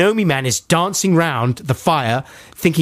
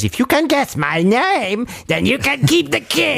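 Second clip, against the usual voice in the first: Rumpelstiltskin voice; 11 semitones higher than usual. Retold or imitated. Gnome dares the queen to guess, in a high squeak. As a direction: tease her in a tiny high voice. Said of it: "And he says".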